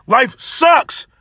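high, sudden shout on life sucks. Real, not acted. Venting at judgmental people.

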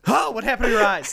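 high startled voice; startled boys. Retold or imitated. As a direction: gasp it out like a scared kid. Boys react in story.

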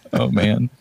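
ordinary talk

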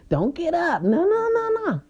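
squealing warning, pitch shooting up; a shrill mock alarm at the wrong move; high-pitched no no no. Telling herself to stay seated, squealing no.

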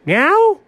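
"Meow" rising high like a question. Acted. Partner adding the song's ending, "Meow" as a question. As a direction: say "Meow" with the pitch shooting up at the end. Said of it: "with a question mark at the end".

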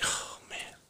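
breathy whispered "oh man"; whispered groan. Retold or imitated. Cop in patrol car groaning under his breath. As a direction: let it out under your breath. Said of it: "And I was like".

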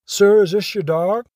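lightly acted officer asking, voice lifting at the end; officer's earnest question. Officer shows dead dog to owner.

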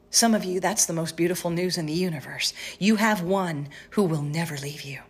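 ordinary talk, nothing marked

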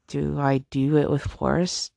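ordinary delivery

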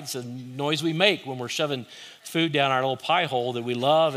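usual speaking voice

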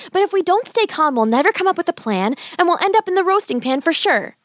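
put-on high, earnest child's voice; urgent girl. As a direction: reason urgently with your brother. Girl reasons urgently with brother.

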